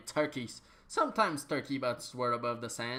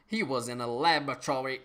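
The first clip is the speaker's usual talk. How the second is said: usual speaking voice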